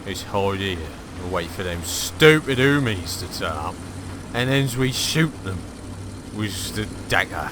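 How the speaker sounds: sneaky villain voice. Acted. Gamer plotting an ambush on enemy tanks, sly villain voice.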